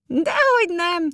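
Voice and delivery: gleeful, very high and loud little elf; drawn-out high exclamation, merrily confident; laughing elf. A narrator's reading. Elf reassures the boy they can play on, high and merry. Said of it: "nevetett Mütyür Manó".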